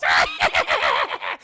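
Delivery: high, loud stuttering scream; imitated cackling screech; mock shriek. Retelling the witch facing the twins, with a high broken screech.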